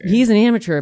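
loud mocking repeat; sarcastic echo. Real, not acted. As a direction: throw the line back sarcastically.

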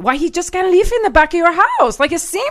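put-on high, loud, insistent Spanish accent; hammed-up Spanish-accented mom pushing her point; mother's Spanish accent. Mother urging daughter to keep husband nearby, Spanish accent. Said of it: "her Spanish accent".

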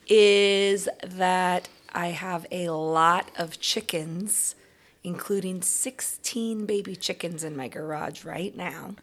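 plain reading voice

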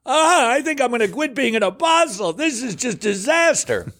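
high, hammed-up despair; mock panic. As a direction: whine it like a mock meltdown. Acting out panicked Paul.

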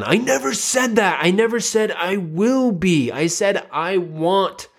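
high, whiny, stressed protest; mock-tearful denial, voice pushed up; imitated upset son. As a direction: protest like a kid on the verge of crying. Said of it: "the son that's crying".

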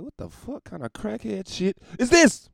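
incredulous. Retold or imitated. His retold reaction to the caller.

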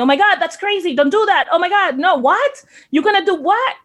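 lightly acted disbelief, pitching up at every question; mock incredulous husband. Husband scolding his wife's wild plan, incredulous.

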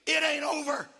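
loud, high-pitched revival preaching building to sustained shouts; full-force preaching. Real, not acted. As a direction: preach it at the top of your voice, building to a hallelujah cry.

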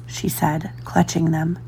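ordinary delivery, nothing marked

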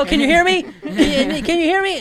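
loud mock hello. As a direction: call into the phone with a laugh, as if nobody hears you. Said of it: "and then you're like".

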